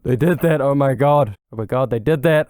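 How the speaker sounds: re-enacted thrilled outburst, held just short of yelling; excited fan reaction. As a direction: gush like a fan who can barely contain it. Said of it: "trying not to yell or get too excited".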